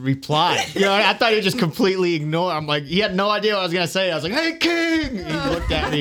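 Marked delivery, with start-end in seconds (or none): 4.3-5.2 high call that carries; high, ringing "Hey, King!" shout. Retold or imitated. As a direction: hail the king from the crowd. Calling out to the king.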